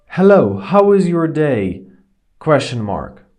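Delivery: loud, clear dictation to the phone; dictating. Shown as a demonstration. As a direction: speak up for the microphone. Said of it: "So let's try something".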